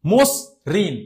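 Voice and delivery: মসৃণ as "mos... rin", rounded first vowel; correct closed O pronunciation. Shown as a demonstration. Teacher demonstrating the word, মসৃণ as "mos-rin". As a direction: sound out মসৃণ in two parts with closed "o". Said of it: "মসৃণ নয়".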